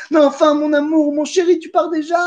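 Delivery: high, sweet pleading voice; coaxing wife. Retold or imitated. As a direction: coax him like a doting wife. Wife coaxing king to stay.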